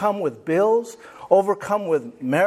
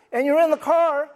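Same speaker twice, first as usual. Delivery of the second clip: normal talking voice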